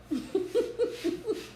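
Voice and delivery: light, bubbling run of laughter; amused laugh. Real, not acted. Reader reacting to her live audience, amused chuckle.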